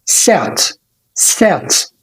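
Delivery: sat, short a; quick short sat. Shown as a demonstration. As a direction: clip the vowel in sat. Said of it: "سريعة كده".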